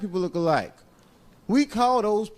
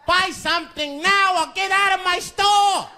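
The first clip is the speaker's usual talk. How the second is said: shopkeeper voice; 13 semitones higher than usual. Retold or imitated. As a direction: bark it out as the angry old store owner. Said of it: "Mister Fong said".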